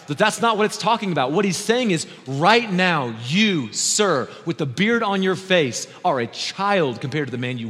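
loud, high, driving sermon delivery; full-force preaching. Real, not acted. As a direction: preach it hard to the whole congregation.

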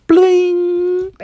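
high-pitched ringing "bling"; sing-song high chime sound; chime ring imitation. Shown as a demonstration. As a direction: ring out like a page-turn chime. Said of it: "hear the chimes ring like this".

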